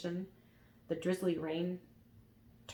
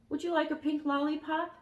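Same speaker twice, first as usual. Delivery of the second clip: put-on higher kind boy voice; 9 semitones higher, 4 dB louder than usual. A narrator's reading. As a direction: offer it higher. Elmer offers.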